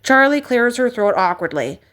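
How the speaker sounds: plain reading voice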